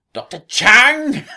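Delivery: high, loud, drawn-out squeal; shrieking Missy impression. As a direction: shriek it out like a madwoman. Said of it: "She goes".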